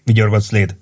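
ordinary delivery

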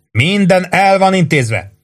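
narrated holler ending in a challenge. A narrator's reading. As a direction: yell down, spacing out the opening words. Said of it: "üvöltötte".

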